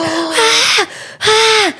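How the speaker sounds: high mock screams; two short, high retold screams; imitated screaming. Recalling the man screaming under the car, imitated screams. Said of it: "we just hear screaming".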